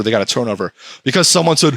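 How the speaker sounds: ordinary talk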